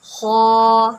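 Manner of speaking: خو said full and heavy from the throat. Shown as a demonstration. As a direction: make خو thick and rounded. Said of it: "حروف مستعلیہ میں سے ہے".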